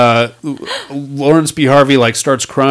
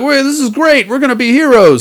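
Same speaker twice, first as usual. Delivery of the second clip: excited; 10 semitones higher than usual. Retold or imitated. Characters high-fiving, retold.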